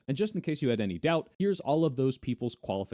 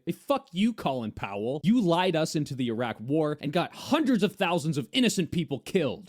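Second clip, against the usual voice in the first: mock angry rant; 5 semitones higher, 5 dB louder than usual. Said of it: "do I say".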